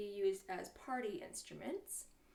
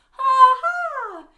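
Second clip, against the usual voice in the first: very high, shouted "ha ha"; big performed ha-ha for kids; loud sung-out laugh; 13 semitones higher, 24 dB louder than usual. Shown as a demonstration. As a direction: belt out a pretend laugh. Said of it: "laugh out loud".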